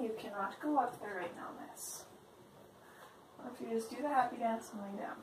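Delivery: soft voice for a pet; gently coaxing the kitty; talking to the cat. Real, not acted.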